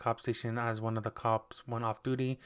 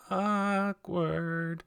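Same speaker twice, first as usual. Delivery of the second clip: awkward; 6 semitones higher than usual. Real, not acted. Awkward moment with therapist.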